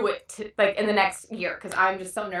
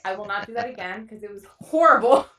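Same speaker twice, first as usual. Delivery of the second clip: normal talking voice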